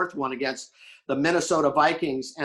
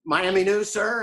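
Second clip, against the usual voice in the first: higher, louder street newsboy cry; newsboy calling out; 6 semitones higher, 4 dB louder than usual. Retold or imitated. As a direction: hawk the paper to a passerby.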